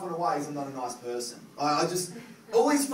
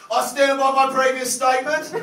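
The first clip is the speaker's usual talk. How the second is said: loud, firm and higher, deadpan proud; proud defiance; 8 semitones higher, 11 dB louder than usual. Retold or imitated. Front-row woman to comedian before everyone, proud and firm. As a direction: stand firm with mock dignity.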